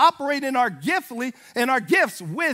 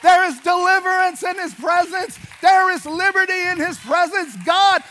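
raised, high preaching cry with stretched key words; impassioned plea; 6 semitones higher, 3 dB louder than usual. Retold or imitated. Preacher acts out begging to reach church, impassioned. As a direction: cry out to be dragged there, building in fervor.

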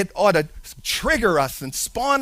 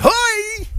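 mock challenge; 13 semitones higher than usual. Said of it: "was like".

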